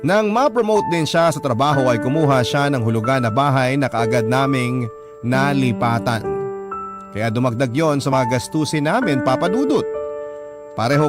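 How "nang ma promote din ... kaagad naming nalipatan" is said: normal talking voice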